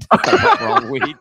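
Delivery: high, loud, slow through laughter; laughing. Real, not acted. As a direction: crack up while saying it. Laughs at his own mistake.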